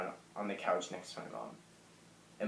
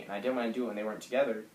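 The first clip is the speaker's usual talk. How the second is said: ordinary talk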